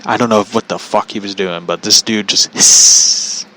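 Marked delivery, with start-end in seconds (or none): none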